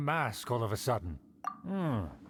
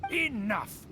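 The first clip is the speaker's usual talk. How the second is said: higher, cutting angry snap; sharp rebuke; 6 semitones higher than usual. Acted. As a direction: slam the door on their questions. Noble silences the questioning guard.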